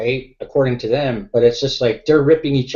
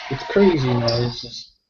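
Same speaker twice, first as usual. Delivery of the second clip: normal talking voice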